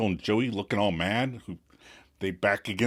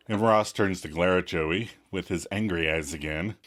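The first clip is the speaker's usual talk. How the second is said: ordinary talk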